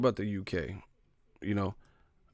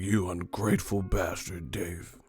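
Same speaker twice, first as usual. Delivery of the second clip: mock muttered scorn; 4 semitones lower, 8 dB quieter than usual. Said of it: "they're like".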